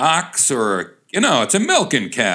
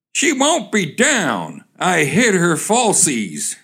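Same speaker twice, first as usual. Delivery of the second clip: high, slow, smug singling-out of each word; slow, high-pitched smugness; smug kid; 6 semitones higher, slower than usual. Acted. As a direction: play a cheeky boy, drawn out and pleased with himself. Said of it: "quite smugly, saying to the".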